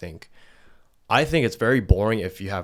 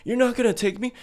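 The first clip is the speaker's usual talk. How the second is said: much higher, mock whiny girlfriend; clingy girlfriend; 13 semitones higher than usual. Imitating a clingy girlfriend left behind, mock whine. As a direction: squeak it out like a hurt girlfriend. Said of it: "And then she's like".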